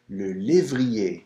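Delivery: le lévrier, slow, crisp é; French é. Shown as a demonstration. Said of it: "E accent aigu".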